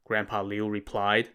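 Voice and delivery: ordinary delivery, nothing marked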